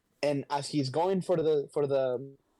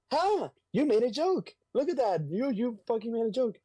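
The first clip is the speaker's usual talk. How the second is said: high, incredulous mock outburst; amazed; 9 semitones higher than usual. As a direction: act out delighted disbelief, pitched way up. Acts out Spider-Man's amazed reaction.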